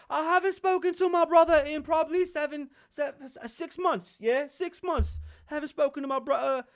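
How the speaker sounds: high, strained put-on prince voice; mock a prince unravelling as he rambles; hammed-up, halting high-pitched confession; Harry impression. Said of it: "I'll be Harry".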